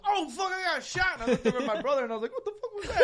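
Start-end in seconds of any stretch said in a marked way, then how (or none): 0.1-1.2 high, slow mock whine of a shot player; imitating a stranger on proxy chat, high and slow. Quoting enemy over proxy chat. 2.3-3.0 high, startled what the; retelling his own shock at a voice in chat. Retold or imitated. Quoting himself, shocked.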